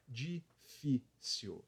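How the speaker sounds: Difícil drawn out very slowly, syllable by syllable; slow syllable demo. Shown as a demonstration. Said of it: "sete letras e três sílabas".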